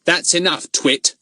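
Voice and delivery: firm adult-style rebuke; stern, grown-up. A narrator's reading. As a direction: put him in his place like a grown-up. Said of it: "in such a stern, grown-up voice".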